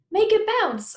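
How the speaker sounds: put-on high, loud eager kid voice; bright eager demand to dad; excited kid. A narrator's reading. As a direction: pitch it up high like a thrilled little boy.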